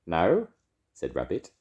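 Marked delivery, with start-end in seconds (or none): 0.1-0.5 lightly disguised, louder and higher. A narrator's reading. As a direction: pretend to be someone else. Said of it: "in a different sort of voice".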